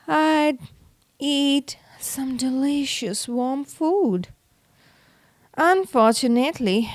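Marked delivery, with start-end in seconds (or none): none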